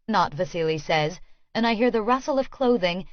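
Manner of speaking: ordinary delivery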